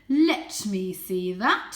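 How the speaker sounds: loud, slow, demanding father cat; father cat taking the toy, slow and loud; commanding father. Acted. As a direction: claim it with authority.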